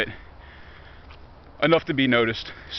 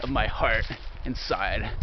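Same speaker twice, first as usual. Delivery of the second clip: mock gloom; 3 semitones lower, 4 dB quieter than usual. Mock gloom, then admits joking.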